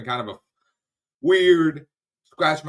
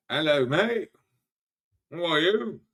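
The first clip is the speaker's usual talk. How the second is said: put-on British accent; 5 semitones higher than usual. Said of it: "The fucking British accent".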